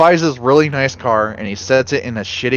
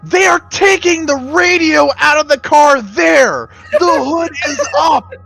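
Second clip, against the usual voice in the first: very high, loud exasperated rant; incredulous outburst; 3 dB louder than usual. Real, not acted. Friend arguing the thieves are obviously stripping the car, high incredulous shouting. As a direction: shout the absurd details in disbelief.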